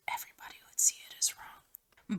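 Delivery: breathy whispered reading, fading almost to nothing; hushed confession, dropping to a bare whisper. Acted. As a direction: whisper it like a guilty secret. Reading the book's lines in a whisper.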